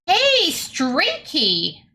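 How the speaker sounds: high, drawn-out happy hail; excited greeting. Real, not acted. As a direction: call out to a long-lost mate, stretching the words. Greets an old acquaintance arriving.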